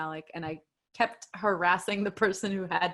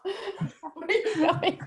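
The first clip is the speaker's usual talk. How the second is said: laughing; 13 semitones higher, much slower than usual. Real, not acted. Calling after running dog.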